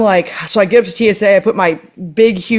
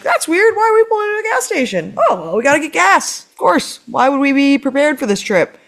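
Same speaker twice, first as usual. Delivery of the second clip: high, fast mock-puzzled sarcasm; sarcastic; 6 semitones higher than usual. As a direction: pitch it up and play fake confusion. Sarcastic inner monologue at gas station.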